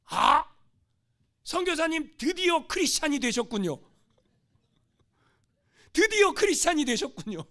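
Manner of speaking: loud, high joyful announcement; delighted exclamation. Acted. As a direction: cheer him on, finally. Natives delighted by missionary.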